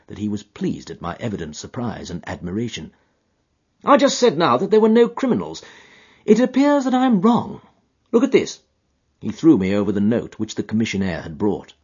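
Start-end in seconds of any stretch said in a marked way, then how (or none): 3.8-8.6 higher, brisk, self-assured detective; Holmes voice. A narrator's reading. Holmes handing Watson the note, higher assured voice. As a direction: voice Holmes a step above the narration, crisp.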